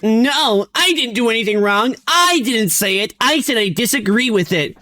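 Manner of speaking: high mocking imitation of a guy insisting he did nothing wrong; mock whiny defensive voice. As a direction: play him as a squeaky, defensive nerd. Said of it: "And then I just like".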